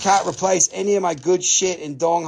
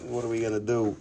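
ordinary talk, nothing marked

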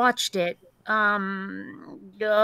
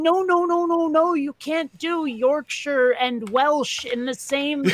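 mock British accent; 4 semitones higher than usual. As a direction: imitate a fussy Brit correcting you, lightly. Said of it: "an actual Brit out there going".

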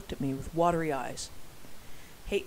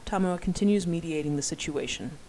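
usual speaking voice

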